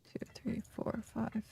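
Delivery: whispering. Real, not acted. Counting items under breath.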